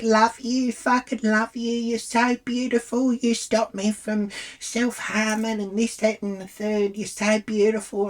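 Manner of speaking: high, overwrought mimicry of an adoring fan; gushing fan. Acted fan gushing in the ear.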